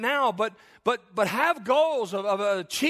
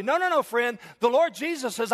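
usual speaking voice

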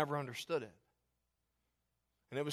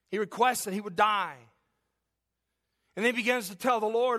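higher, louder preacher's cry; full-force preaching; 5 semitones higher, 4 dB louder than usual. Acted. Pastor urging congregation to get help, preaching loud. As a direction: drive it home from the pulpit.

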